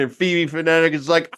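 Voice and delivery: usual speaking voice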